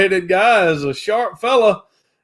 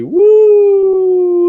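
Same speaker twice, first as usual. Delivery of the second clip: 1 excited whoop; 13 semitones higher, 7 dB louder than usual. Real, not acted. Video feed suddenly jumps back.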